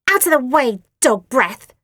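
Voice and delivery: loud snarling sister; bully snarling at a toddler; snarled. A narrator's reading. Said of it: "snarled Asti".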